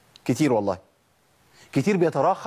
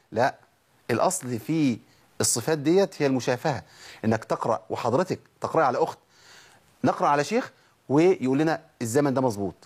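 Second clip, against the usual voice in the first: ordinary delivery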